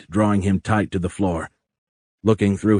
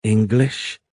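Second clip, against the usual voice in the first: put-on lightly mechanical South African-tinged accent; tentative word in an odd, South African-like accent; 2 semitones higher than usual. A narrator's reading. Alien checks the language's name, odd South African-like accent. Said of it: "The odd accent sounded like that of a South African".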